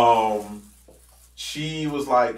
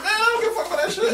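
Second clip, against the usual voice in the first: high, fast, laughing through it; joking with a laugh; faster than usual. Retold or imitated. Joking to the supervisor, laughing high and fast. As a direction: laugh it off so he knows it's a joke. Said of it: "I'm joking".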